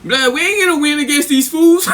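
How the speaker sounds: high mock dismay, breaking into laughter; mimicked exasperation. Opponent telling his homies to quit, mock dismay. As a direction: pitch it up as a baffled quitter.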